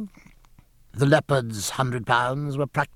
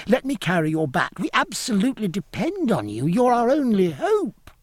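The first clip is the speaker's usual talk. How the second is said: put-on high, earnest boy's voice rising at the end; high-pitched eager begging; pleading child; 8 semitones higher than usual. A narrator's reading. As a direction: implore him like a desperate youngster. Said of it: "cried the child again".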